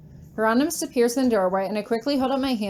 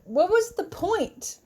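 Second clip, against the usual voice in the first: upset high; 9 semitones higher, slower than usual. Acted. Angry at husband.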